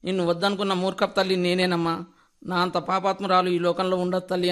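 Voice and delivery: ordinary delivery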